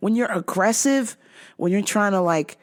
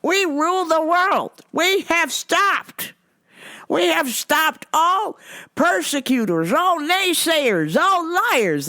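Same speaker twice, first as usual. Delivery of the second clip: higher, slow sermonizing stranger; mock zealot lecturing a cashier; preachy Rome guy; 6 semitones higher, slower than usual. Man proclaims superiority.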